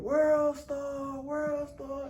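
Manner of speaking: high, drawn-out crowd chant imitation; imitated crowd scream. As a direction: holler it like a whole club joining in. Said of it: "Everybody started screaming".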